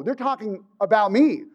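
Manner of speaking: usual speaking voice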